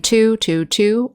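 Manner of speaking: "two" with a strong aspirated t; hard T in two. Shown as a demonstration. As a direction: stress the puff on "two". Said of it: "For example".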